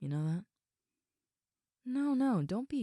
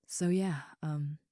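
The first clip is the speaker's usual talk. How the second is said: ordinary talk, nothing marked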